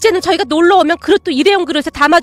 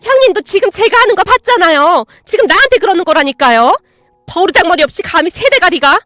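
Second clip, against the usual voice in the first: upset aunt; 5 semitones higher, 6 dB louder than usual. Acted. Aunt protests to mother.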